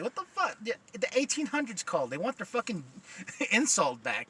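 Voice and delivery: high-pitched mock disbelief; incredulous comeback. His incredulous comeback to the insult.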